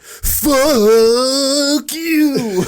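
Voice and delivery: loud, high movie-quote holler; over-the-top yell. Retold or imitated. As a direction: belt it out like an overacted film line. Imitating over-the-top film yell.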